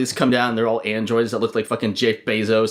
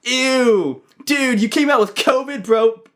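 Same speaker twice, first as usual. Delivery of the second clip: disgusted guy; 11 semitones higher, 3 dB louder, slower than usual. Pretend. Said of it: "the guy behind you is like".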